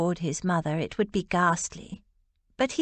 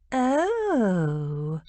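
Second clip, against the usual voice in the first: a drawn-out realising oh; long note of discovery. A narrator's reading. Lily realising George likes the Duke, dawning discovery. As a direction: let the penny drop slowly. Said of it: "on a long note of discovery".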